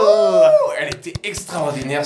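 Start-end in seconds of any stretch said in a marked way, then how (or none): 0.0-0.7 high, long "wooh" of a cheering crowd; shrieked cheer of victory; loud celebratory whoop. Real, not acted. Cheers the right answer.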